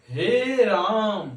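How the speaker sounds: हे राम with both vowels held very long; very slow, sustained हे राम; drawn-out vowels. Shown as a demonstration. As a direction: stretch हे and राम right out. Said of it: "इस प्रकार से हम बोलने का प्रयास करते हैं".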